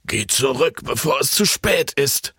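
pressing warning, lightly voiced old man; old man pleading a warning to Jan; urgent old man. A narrator's reading. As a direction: warn him it's nearly too late. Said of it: "befahl der Alte".

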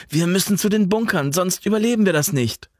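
high, pressing plea; urgent plea. A narrator's reading. Jan urging Alva toward the bunkers, desperate. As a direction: push her to run for the bunkers.

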